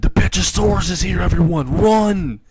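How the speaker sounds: high, loud alarm yell at the whole school; panicked scream. Acted. Issei screams to the school that the monster is here, a high, loud panicked yell. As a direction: shriek the warning and run. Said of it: "And he screamed".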